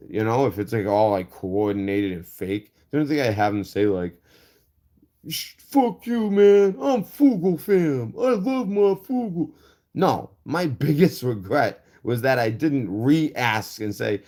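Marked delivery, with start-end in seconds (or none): 5.3-9.5 high, slow, hammed-up fan boasting; mocking fan voice. Vlogger mimicking the fake veteran he caught, hammed-up high mock voice. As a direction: sneer it out like a dumb superfan.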